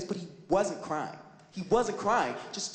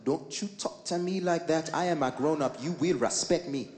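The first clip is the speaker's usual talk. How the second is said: put-on Trinidadian babysitter; 3 semitones lower than usual. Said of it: "Trinidadian babysitter comes over and goes".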